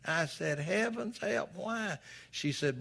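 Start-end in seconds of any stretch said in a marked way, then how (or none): 0.6-2.0 mock shock. Shocked at the cashier's rule.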